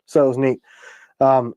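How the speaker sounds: normal talking voice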